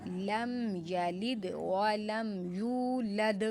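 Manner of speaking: slow, measured Quranic recitation. Shown as a demonstration. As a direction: draw out the verse calmly. Recites the verse. (آہستہ تلاوت)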